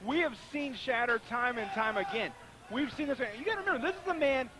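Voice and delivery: ordinary talk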